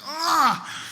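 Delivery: high startled "ah"; startled yelp. Retold or imitated. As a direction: yelp it in alarm. Seeing ball hit her.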